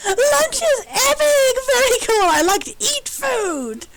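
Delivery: high, slow, squealing delight; a childlike robot bursting with joy. Acted. Robot squealing about lunch, high and drawn out.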